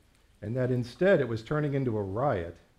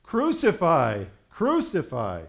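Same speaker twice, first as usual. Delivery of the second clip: loud, slow, raised cry; crowd's shout; 5 semitones higher, 6 dB louder, slower than usual. A narrator's reading. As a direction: shout each word as a demanding mob. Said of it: "they shouted".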